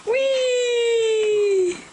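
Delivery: high, drawn-out excited squeal; playful whee. Real, not acted. Playing airplane with the baby.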